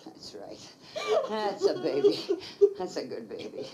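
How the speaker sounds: comforting a terrified friend in her arms; soothing comfort. Acted. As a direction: hush her like a crying child. Comforting a sobbing woman.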